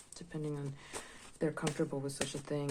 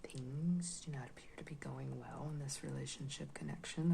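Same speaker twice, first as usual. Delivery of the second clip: whispering; 5 dB quieter than usual. Real, not acted. First read of the cards.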